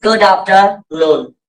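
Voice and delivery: गुड afternoon slowly, with no R in after; measured teacher's demonstration; slow model phrase. Shown as a demonstration. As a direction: model it word by word. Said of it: "क्या बोलेंगे?".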